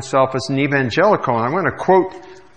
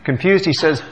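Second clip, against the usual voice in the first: ordinary talk, nothing marked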